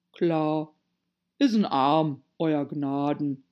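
slow, rustic pronunciation with a long "Arm"; dialect servant. A narrator's reading. Pat telling his master what it is, broad slow dialect. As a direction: draw out "Arm" in a broad country voice. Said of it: "Er sprach es wie Arm aus".

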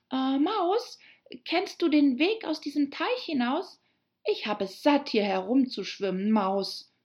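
put-on high girl character addressing a mouse; Alice, fragend. (hohe, fragende Mädchenstimme)

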